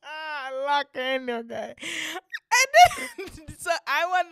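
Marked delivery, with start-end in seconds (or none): 0.0-1.8 high, laughing confession; cracking up at your own misdeed; helpless laughter. Real, not acted. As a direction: squeeze the words out through laughter. Laughing too hard to continue.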